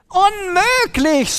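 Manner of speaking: very high, slow cry of dismay; shouting. A narrator's reading. Woman looking at her dirty house cries out in dismay. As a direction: wail the word out in horror. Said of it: "schrie sie".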